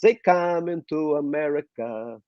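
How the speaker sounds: put-on slow singing in a thick Russian accent; drawn-out Russian-accented song line. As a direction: sing it like a new Russian immigrant. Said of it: "the Russians have a very thick accent".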